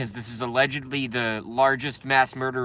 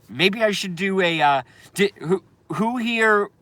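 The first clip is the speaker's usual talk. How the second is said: ordinary delivery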